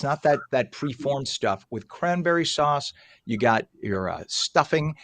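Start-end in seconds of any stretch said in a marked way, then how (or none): none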